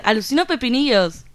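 ordinary delivery

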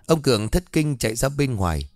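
ordinary delivery, nothing marked

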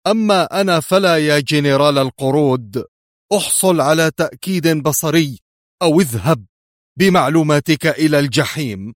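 high, loud furious dismissal; angry shout. A narrator's reading. Yuri sending the general to hell, angry shout. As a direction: shout him down angrily. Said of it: "صاح يوري".

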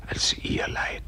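breathy whisper closing the prayer. Acted. Praying man whispers to God, hushed. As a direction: hush it like a secret to God.

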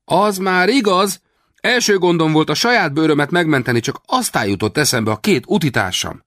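foolish amazement. A narrator's reading. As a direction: play the simpleton. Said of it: "bárgyú álmélkodással". (bárgyú álmélkodás)

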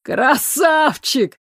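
slow, high, laughing yell; laughing shout. Acted. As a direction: jeer through laughter. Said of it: "заржали парни".

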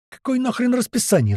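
high-pitched irate retort; angry roar. A narrator's reading. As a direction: explode at a silly remark. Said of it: "взревел Туман". (возмущённый рёв)

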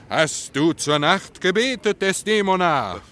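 slow, loud, high theatrical declaiming; mock-grand Shakespeare line, slow and booming; hammed-up Othello. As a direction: ham it up like a stage tragedian. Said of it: "diesen eifersüchtigen Klotz Othello".

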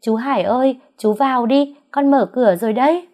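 put-on little girl calling out to the rain; child shouting. A narrator's reading. Daughter calling uncle in from the rain, loud and high. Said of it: "chạy ra hét lên".

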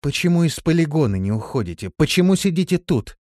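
firm, pressing questions; stern questioning. A narrator's reading. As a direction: interrogate him strictly. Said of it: "строго посмотрела на Крепыша и спросила".

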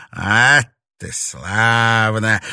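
slow, loud, gloating approval; pleased villainess savouring submission; satisfied. A narrator's reading. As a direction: draw it out with smug pleasure. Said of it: "удовлетворённо сказала четырёхрукая".

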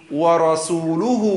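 ورسوله slow, lam with u, wa-rasuluh; correct damma. Shown as a demonstration. Teacher repeats the correct vowel, slow and clear. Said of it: "اللام مضمومة".

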